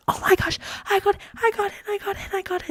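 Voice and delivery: high squealing breathy "I got it"; retold screaming joy. Reliving opening the acceptance letter, breathless high squeal. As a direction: squeal it out, barely voiced. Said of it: "I'm screaming".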